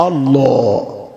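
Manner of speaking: আল্লাহ low and long, thick rounded L; heavy L. Shown as a demonstration. Preacher teaching the word, heavy drawn-out L in আল্লাহ. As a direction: hold a heavy "Allaw" in আল্লাহ, very slowly. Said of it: "লটা মোটা হবে".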